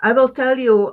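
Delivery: ordinary talk, nothing marked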